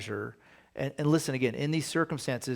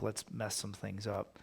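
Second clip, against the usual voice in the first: mock bored God; 3 semitones lower, 9 dB quieter than usual.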